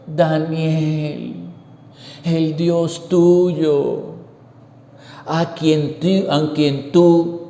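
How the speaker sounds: slow, mournful call down into the pit; a worried king calling his servant, slowly and sadly. Acted. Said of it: "él le puso voz triste".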